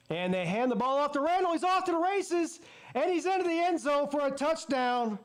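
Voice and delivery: high-pitched, thrilled play-by-play; excited touchdown call. Real, not acted. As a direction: call the breakaway score with rising excitement. Calling a touchdown run live.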